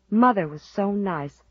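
plain reading voice

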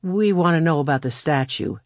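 plain reading voice

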